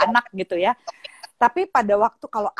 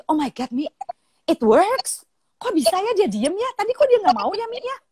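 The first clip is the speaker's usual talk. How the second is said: put-on high, swooping girlish excitement; amazed daughter; 8 semitones higher than usual. Daughter phones mother back after success, high imitated amazement. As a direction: imitate a schoolgirl astonished that it worked. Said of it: "terus dia telepon lagi".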